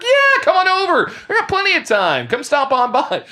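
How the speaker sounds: a hammed-up, high, carefree "come on over"; breezy overconfident. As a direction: sound like you have all the time in the world. Retelling his overconfident reply to dad.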